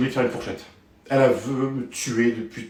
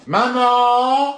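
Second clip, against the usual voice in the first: loud high yell for maman; shouting for mom; 8 semitones higher, 7 dB louder than usual. Acted. Kid yelling for mom to tattle on his brother. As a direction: call mom at the top of your lungs. Child calling mother.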